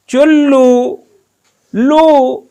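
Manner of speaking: चुल्लू with लू said high and loud. Shown as a demonstration. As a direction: hit the लू hard. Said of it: "जोर से बोल रहे हो तो बड़ा उक लगा ना". (चुल्लू में लू पर ज़ोर, ऊँचे सुर में)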